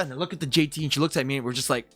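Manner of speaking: normal talking voice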